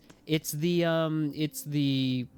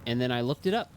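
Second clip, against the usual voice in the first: ordinary talk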